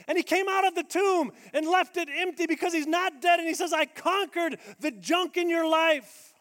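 high, loud, driving climax; full-force preaching. Real, not acted. Preacher declaring the cross and empty tomb, at full force. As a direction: build the room to a peak.

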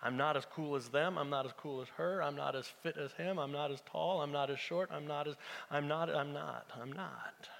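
low, soft litany of inadequacy; self-doubting thoughts. Acted. Preacher voicing listeners who feel not good enough, low and dejected. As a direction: let it shrink away, low and defeated. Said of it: "Some of you are thinking".

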